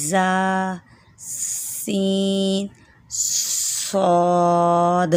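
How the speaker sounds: زا سین صاد drawn very long with a hissing whistle; sharp whistled letter demo; whistling letters. Shown as a demonstration. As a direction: hiss each letter hard and long. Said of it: "سیٹی کی آواز نکالنی ہے".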